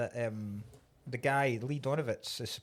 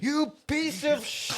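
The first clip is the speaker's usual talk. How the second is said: high, drawn-out mock rage; acted furious outburst; 11 semitones higher, 4 dB louder, much slower than usual. Film fan playing the betrayed boss cursing Elliot, furious. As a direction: spit out the insult, high and slow.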